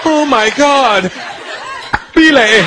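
high, slow mock moan; woman in bed. His woman making love, high drawn-out mock passion. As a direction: moan slowly, then click into the name.

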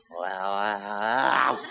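long, low pained moan; drawn-out hungover "ow"; hungover groan. Acted. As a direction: groan as if waking up battered. Battered man groans waking next morning.

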